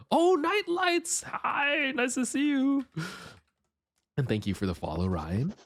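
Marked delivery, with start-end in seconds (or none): 0.1-2.8 cute anime streamer. Acted. Greeting new chatter, cute voice.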